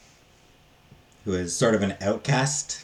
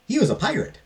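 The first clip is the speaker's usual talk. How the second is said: much higher, delighted burst; excited reveal; 4 dB louder, faster than usual. Real, not acted. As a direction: pop the fun fact with glee. Delighted aside about mathematician pirate.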